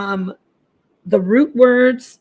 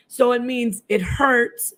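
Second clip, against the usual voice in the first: ordinary talk, nothing marked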